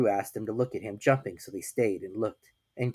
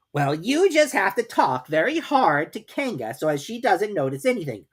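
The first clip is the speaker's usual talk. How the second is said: brisk, bossy rabbit, a little higher; Rabbit's voice; 10 semitones higher, 5 dB louder than usual. A narrator's reading. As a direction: Rabbit explaining the plan. Rabbit instructs Pooh.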